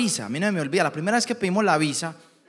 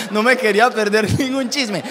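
ordinary talk

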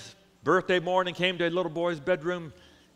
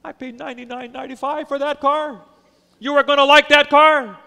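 loud, high angry outburst from the father; a dad losing his temper over the price; 10 semitones higher, 16 dB louder than usual. Acted. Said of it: "The father began to get mad".

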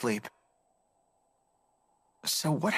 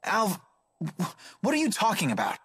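flustered surprise; 5 semitones higher than usual. Acted. Teased about staying with Milla.

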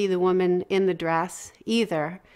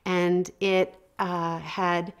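ordinary talk

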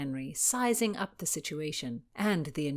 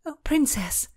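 breathless; 9 semitones higher, 5 dB louder than usual. Acted. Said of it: "Constance was breathless".

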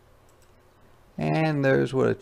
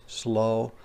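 ordinary delivery, nothing marked

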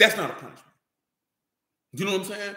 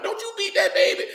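much higher voiced grandma pleading; imitating grandma. Grandma begging granddaddy not to whip the baby, high mock plea. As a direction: squeak it out like a fussing grandmother.

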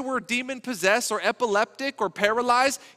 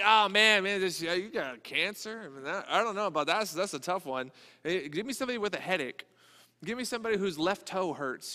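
mock reluctant healer; 3 semitones lower, 5 dB quieter than usual. Mock hesitant healer, unwilling.

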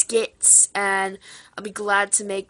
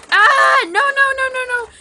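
shrieking no over and over, much higher and louder; panicked shouting; 9 dB louder than usual. Pretend. Friend grabbing something, shrieking no in mock panic.

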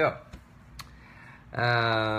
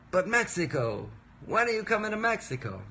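mock Italian accent, pitched up high; affected Italian accent; 10 semitones higher than usual. Fans asking when he's coming to Mexico, in an affected Italian accent. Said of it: "this affected Italian accent".

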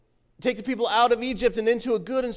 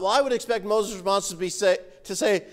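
ordinary talk, nothing marked

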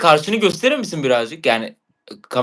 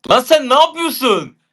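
shocked outburst; 9 semitones higher, 4 dB louder than usual. Real, not acted. Arkadaşına şaşkın bağırış.

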